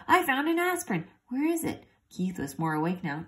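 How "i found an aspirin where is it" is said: put-on high, eager sick boy; child voice. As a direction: perk up like a feverish kid.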